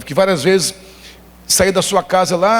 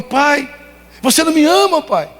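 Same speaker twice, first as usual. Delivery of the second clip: put-on hurt little boy; 11 semitones higher than usual. Three-year-old crying to his father that he isn't loved, very high. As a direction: accuse your father through tears. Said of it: "Ele falou".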